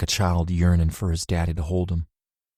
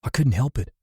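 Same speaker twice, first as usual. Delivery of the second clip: usual speaking voice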